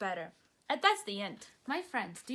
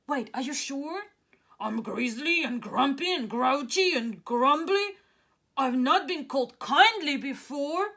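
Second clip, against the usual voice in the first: loud, hesitant giant voice; a grumpy giant caught off guard; unsure giant; 8 dB louder than usual. Acted. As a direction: giant to the children, doubtful.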